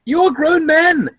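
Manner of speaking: reacting to grown men acting like schoolboys; exasperated. Real, not acted. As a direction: plead with them to act their age. Exasperated at the cringe.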